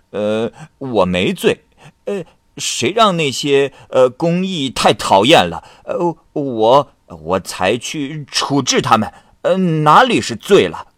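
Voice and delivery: tipsy scholar protesting to his friend; drunken denial. Acted. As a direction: insist you're sober while plainly drunk. Drunk man denies being drunk.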